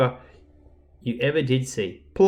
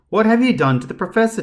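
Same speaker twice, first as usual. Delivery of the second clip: higher, louder and fast; demanding; 8 semitones higher, 5 dB louder, faster than usual. A narrator's reading. Larka confronts the doctor, loud and fast. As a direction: demand an answer urgently. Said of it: "demanded Larka".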